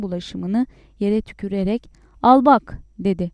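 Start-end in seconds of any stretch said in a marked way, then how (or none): 2.2-2.8 loud, higher angry jab; sudden raised, cross voice; angry challenge. A narrator's reading. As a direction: thrust it at him loudly. Mother spits, throws back proof.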